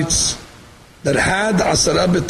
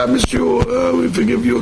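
higher-pitched imitation of a tearful reunion; mock emotional cry; 8 semitones higher than usual. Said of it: "when you have an emotional cry".

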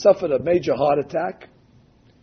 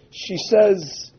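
usual speaking voice

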